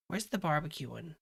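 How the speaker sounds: ordinary delivery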